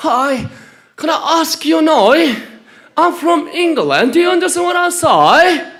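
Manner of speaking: high, slow put-on British English, laughing; mock English accent. 영국 사람 흉내 내며 높고 느리게 웃으며 말하기. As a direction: ham up an Englishman who barely speaks English. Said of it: "영국".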